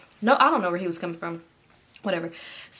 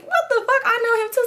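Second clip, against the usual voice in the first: squeaky, much higher mock disbelief; hammed-up shocked boyfriend. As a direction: shriek it in disbelief. Enemy shocked, voiced very high.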